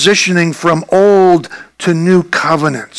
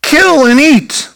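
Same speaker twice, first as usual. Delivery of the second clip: raised, higher voice calling out a command; commanding heavenly voice; 5 semitones higher than usual. Acted. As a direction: proclaim it as a voice from heaven. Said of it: "there came a voice to him".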